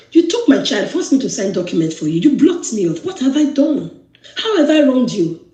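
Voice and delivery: upset confronting. Retold or imitated. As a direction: demand an answer, voice rising with hurt. Confronting husband on phone, retold.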